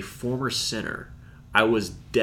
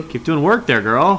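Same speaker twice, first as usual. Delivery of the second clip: cheerful shout-out; 6 semitones higher, 8 dB louder than usual. Real, not acted. Shout-out to missionary.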